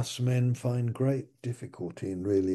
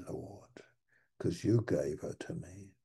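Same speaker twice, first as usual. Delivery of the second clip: sheepish whisper; whispered excuse; 4 semitones lower, 7 dB quieter than usual. Acted. Adam whispering blame to God. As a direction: mutter the excuse low.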